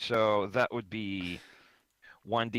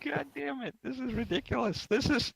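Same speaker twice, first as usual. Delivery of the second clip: exasperated. Pretend. Frustrated over flunked roll.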